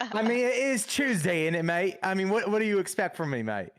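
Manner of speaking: put-on awful Cockney accent. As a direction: do a bad East London Cockney. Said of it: "my awful accent".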